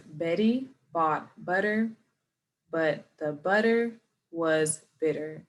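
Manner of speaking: slow, careful tongue twister; slow model read; slow first try. Shown as a demonstration. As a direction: go word by word slowly. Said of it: "we do wanna start off slow".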